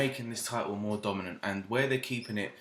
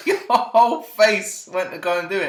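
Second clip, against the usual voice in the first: talking through loud laughter; laughing; 9 semitones higher, 11 dB louder than usual. Real, not acted. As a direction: laugh while you say it. Laughing at a failed sneeze.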